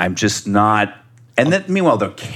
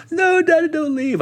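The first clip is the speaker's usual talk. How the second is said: put-on very high little-girl plea; mock tearful squeak of protest; crying daughter. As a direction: imitate a small child begging. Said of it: "she's crying".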